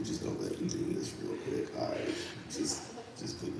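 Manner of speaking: soft, whispered please; hushed plea. Real, not acted. Comic begging host in front of crowd, soft whispered plea. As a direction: half-whisper a mock plea. Pleading not to act.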